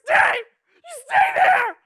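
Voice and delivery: high, very loud drawn-out yell; acted shout. Man ordering his dog to stay behind in a field, shouted. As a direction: holler it out, stretched and urgent.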